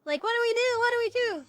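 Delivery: high, loud mock panic. Vlogger imagining the heroes' panic, high and loud. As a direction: flap in theatrical alarm. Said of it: "I'm like".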